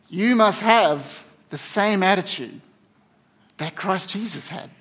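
slow scripture reading, broken into phrases; slow, deliberate repeat. Real, not acted. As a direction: drive the verse home slowly, pausing between phrases. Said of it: "gotta say this slowly".

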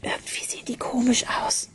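very soft, uneasy whisper. A narrator's reading. Charlotte asks Ben about the strange mountains, whispering softly. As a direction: whisper it as a secret worry. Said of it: "flüsterte sie".

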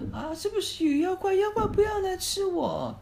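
high, loud frightened squeal, slowing down; terrified boy. Acted. Scared boy alone in the dark forest, high terrified squeal. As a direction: squeal it as if the monster is right there. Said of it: "马克心里一害怕".

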